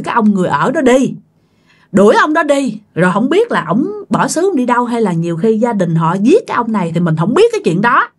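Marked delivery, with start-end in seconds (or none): none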